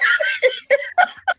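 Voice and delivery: high squealing burst of laughter; helpless laugh. Real, not acted. Wife bit collapses into laughing, high giggle. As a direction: crack up at your own bit.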